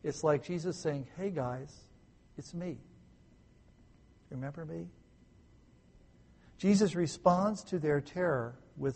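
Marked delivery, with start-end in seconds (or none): 1.2-4.9 soft, casual reassurance; informal, calming small talk. Acted. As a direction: say it quietly, like greeting old friends. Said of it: "informal speech, informal conversation".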